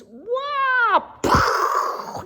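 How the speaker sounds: high, loud "wah" into a puffed whoosh; tumbling cry and splash. Acted. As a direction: play a playful plunge with your voice. Bear tumbles over the waterfall.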